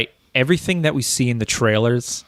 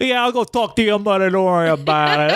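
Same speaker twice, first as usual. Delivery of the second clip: put-on higher New York accent; 6 semitones higher than usual. Joking with a co-host, Hawkeye in a New York accent. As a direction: play Hawkeye as a New York guy, easygoing. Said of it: "I'm giving him a New York accent".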